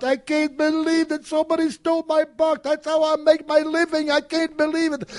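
high, frantic mock panic of an old man; panicked shoe shiner. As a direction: squeal it out like he's lost everything. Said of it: "he's freaking out".

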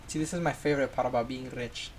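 ordinary talk, nothing marked

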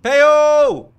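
loud excited call across the room. Real, not acted. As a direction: shout the name out with joy. Greeting a streamer friend.

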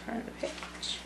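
barely audible whisper. Real, not acted. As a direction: whisper it to yourself. Quiet instruction while reading.

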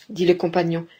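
usual speaking voice

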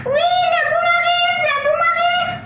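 very high, loud panicked yell; shouting at the top of lungs. Acted. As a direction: scream it as loud as you can. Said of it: "en criant à tue-tête".

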